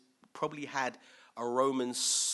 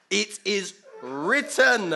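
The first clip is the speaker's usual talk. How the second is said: loud, slow declaration, pitch raised; emphatic rebuke; 6 semitones higher, 11 dB louder, much slower than usual. Acted. Preacher voicing Jesus rebuking Satan, emphatic. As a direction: draw out each word forcefully.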